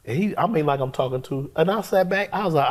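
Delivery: ordinary talk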